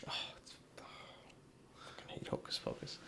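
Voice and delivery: barely-there whispered loathing; whispered disgust. Real, not acted. Groaning aside about hated film.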